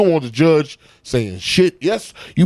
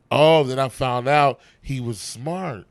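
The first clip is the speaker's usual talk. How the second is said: ordinary delivery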